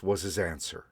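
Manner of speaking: ordinary delivery, nothing marked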